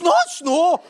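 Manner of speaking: giggling, stubborn retold protest; laughing insistence. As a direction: laugh while arguing the point. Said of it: "I says".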